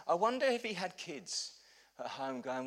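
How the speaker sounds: ordinary talk, nothing marked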